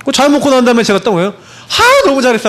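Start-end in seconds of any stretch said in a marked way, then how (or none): 1.7-2.5 high-pitched acted praise for a child; delighted praise. Father praises child for eating well, high and delighted. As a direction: gush over a child's small success. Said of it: "하고 칭찬해주고".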